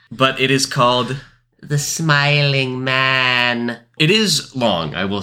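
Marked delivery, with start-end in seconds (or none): 1.6-3.8 very slow, drawn-out announcement; ominously elongated horror-story title; spooky title. Acted. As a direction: stretch every syllable like an eerie title.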